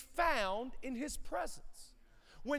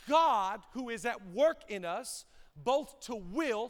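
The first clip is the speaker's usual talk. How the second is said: slow emphatic verse; 4 dB louder, slower than usual. Real, not acted. Congregation repeating verse slowly.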